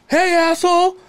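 high, loud put-on holler; brash caricature, high and loud; Howard. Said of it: "that's how Howard talks".

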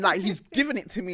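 ordinary delivery

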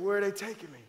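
ordinary talk, nothing marked

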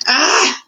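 high, exasperated "ah!" from a king; exasperated cry. A narrator's reading. King refusing to hear of rats, crying out in exasperation. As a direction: cry out to drown the words.

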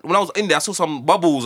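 ordinary talk, nothing marked

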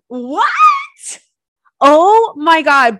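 loud, high, slow gushing; excited shriek. Real, not acted. Telling listeners about the hot mechanic, loud high squeal. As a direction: squeal with delight.

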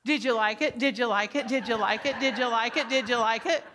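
asked over and over, same tune; same question on repeat, patient all day; repetitive teacher. Shown as a demonstration. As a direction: loop the question like a broken record. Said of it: "here's what you're gonna sound like".

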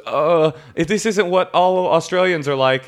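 high, slow, lightly voiced girlfriend; a hint of the embarrassed girlfriend; imitating the girlfriend. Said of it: "his girlfriend goes".

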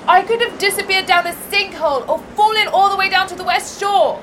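loud, breathless shouting from afar; calling out. Acted. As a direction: shout it across the hillside, frazzled. Calling out after a steep climb.

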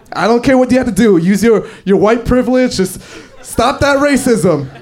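high, emphatic insistence, slowing at the end; exasperated demand. Acted. Customer demands his agent stop racism, exasperated.

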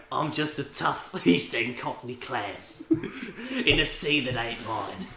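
hammed-up East End hard man, kept quiet; Jason Statham. Mock film trailer, Jason Statham.

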